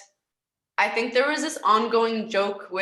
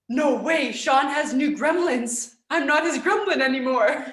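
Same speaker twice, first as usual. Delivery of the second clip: gasp, then a higher, hammed-up dismay; mock shock; 5 semitones higher, 3 dB louder than usual. Former player finding out her coach has new gremlins, in hammed-up dismay. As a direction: play up being replaced, half-joking.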